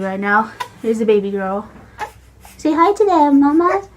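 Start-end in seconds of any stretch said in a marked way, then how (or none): 2.6-3.9 high singsong prompt to the infant. Real, not acted. Mom prompting baby.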